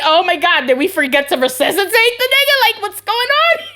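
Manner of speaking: high, loud mock-alarmed squeal. Nurse mocking alarm about a patient, high and loud. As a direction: play up fake hospital alarm. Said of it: "And I'm like".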